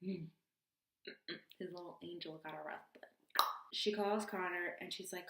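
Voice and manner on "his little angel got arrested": soft, whispered little confession; hushed mock-cute aside. Girlfriend of deployed man admitting arrest, hushed mock-cute aside. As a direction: whisper it like a naughty secret.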